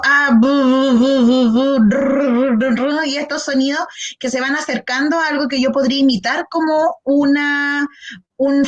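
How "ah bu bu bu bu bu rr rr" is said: louder mock baby babble bu bu rr; baby babbling. Shown as a demonstration. Therapist demonstrates baby consonant babble, louder mock babbling. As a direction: play a babbling baby, buzzing lips. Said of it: "ya partimos con esto".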